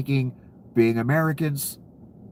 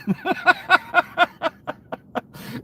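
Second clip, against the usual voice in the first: high laugh. Real, not acted. Cracking up at own joke.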